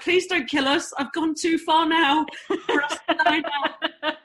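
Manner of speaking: high, half-laughing mock panic begging the driver; a hammed-up plea for her life, laughing through it; mock terrified plea. Scared passenger begging friend driver.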